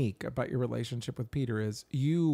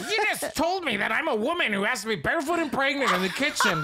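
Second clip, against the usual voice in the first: much higher, louder laughing indignant voice; mock outraged wife; 10 semitones higher, 4 dB louder than usual. Wife accusing husband over water, high laughing outrage. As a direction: ham up her offended outrage.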